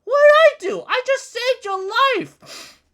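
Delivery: high cartoonish cry of injured pride; indignant King Harold. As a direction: squeak out the hurt innocence of a wronged giant. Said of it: "King Harold's like".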